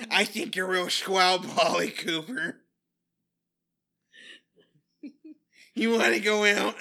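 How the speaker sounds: loud, high, slowed-down awkward voice; hammed-up awkward suitor, drawn out and loud; Jason's awkward voice. Imagined Jason asking Polly out, awkward and loud. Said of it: "does the actor have an awkward voice".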